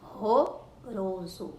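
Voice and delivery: "Ronroroso" very slowly, syllable by syllable; strong R demonstration. Shown as a demonstration. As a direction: draw out "Ronroroso", strong breathed first Rs. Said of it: "o R tem um som forte". ("Ronroroso" bem devagar, com R forte no começo)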